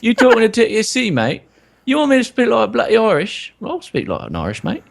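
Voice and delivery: high-pitched, cocky fake Irish accent; badly faked Irish, challenging a mate; mangled Irish that drifts towards Manchester; attempted Irish accent. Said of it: "wants you to do an Irish accent".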